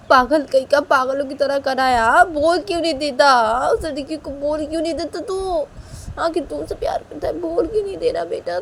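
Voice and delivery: high-pitched, insistent scolding; exasperated mother. Acted. मां झुंझलाकर बेटे को डाँटती है. (झुंझलाई माँ की ऊँची डाँट)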